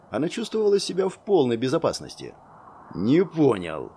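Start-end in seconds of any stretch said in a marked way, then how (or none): 2.9-3.9 low menacing growl. A narrator's reading. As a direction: growl it like an angry beast. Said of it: "прорычал Рыжий".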